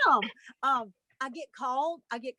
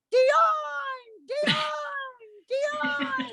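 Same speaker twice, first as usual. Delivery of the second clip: high, loud peacock cry of a name; piercing mock bird call, drawn out; imitated peacock call; 3 dB louder, much slower than usual. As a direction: screech the name like a calling peacock. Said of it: "it would call my name".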